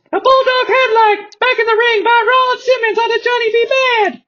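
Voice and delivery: very high, fast play-by-play; high announcer voice. Acted. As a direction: rattle off the move like an announcer. Announcer calls a move.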